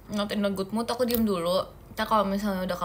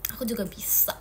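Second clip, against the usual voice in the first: whispered retort; 7 dB quieter than usual. Real, not acted. Playful retort to viewers.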